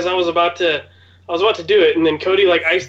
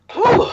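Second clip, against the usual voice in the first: loud high whew; hot sauce exhale; 5 dB louder than usual. Real, not acted. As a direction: blow out the burn. Blowing out after eating hot sauce.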